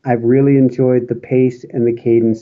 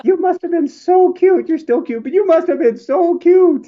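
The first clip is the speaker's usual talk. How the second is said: much higher, sing-song coo; 14 semitones higher than usual. Real, not acted. Cooing to her co-host about him as a little boy.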